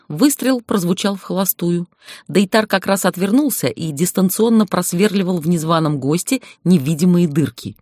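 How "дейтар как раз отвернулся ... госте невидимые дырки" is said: usual speaking voice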